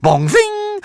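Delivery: a very high, ringing bong and zing; ping it up high like metal; high ringing dart sound. Acted. Darts striking the Hogfly, very high ringing bong.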